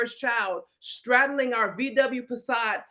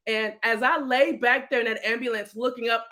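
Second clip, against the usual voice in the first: ordinary talk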